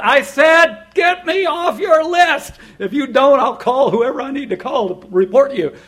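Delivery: high, very loud outburst at a caller; exasperated full-volume telling-off; angry shout. Retold or imitated. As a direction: shout a drawn-out demand, then threaten. Retold shouting at persistent telemarketer.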